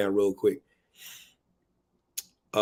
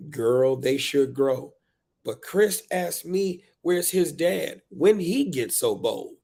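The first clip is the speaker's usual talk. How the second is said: higher, slow, drawn-out verse; heartfelt poem reading; 5 semitones higher, slower than usual. Acted. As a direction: confide it as a worn-out parent. Performs book's parent.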